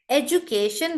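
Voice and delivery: British "education" with a clear j sound; British pronunciation. Shown as a demonstration. Teacher answering an accent question, British "education" with j. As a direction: say "education" as "ej-u-CAY-shun". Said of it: "with a j is British".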